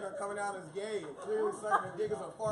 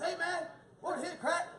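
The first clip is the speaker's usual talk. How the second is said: mock homeless man; 10 semitones higher than usual. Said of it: "He goes".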